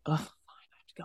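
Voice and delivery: whispered, grudging sigh; reluctant whisper. Retold or imitated. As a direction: grumble it under your breath. Reluctant inner groan, whispered.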